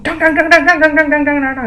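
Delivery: loud, high rapid-fire "dong" blows; imitated smashing. Coworker smashes bug with spoon, loud imitated banging. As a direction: pound out frantic spoon hits. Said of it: "she's, like, smashing it".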